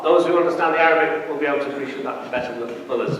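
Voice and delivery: ordinary delivery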